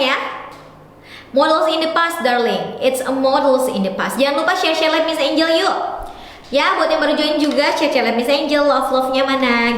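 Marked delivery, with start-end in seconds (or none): none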